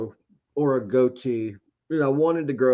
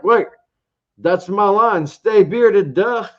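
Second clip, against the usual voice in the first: higher and louder, a mock aha moment; excited realization; 8 semitones higher, 7 dB louder, slower than usual. Realizing mid-video that it's his catchphrase, excited and loud. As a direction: snap into sudden recognition of your own line.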